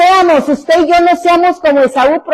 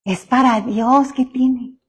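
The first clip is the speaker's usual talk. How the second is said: lazy mumble; 4 semitones lower, 7 dB quieter, slower than usual. Retold or imitated. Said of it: "lo hemos escuchado".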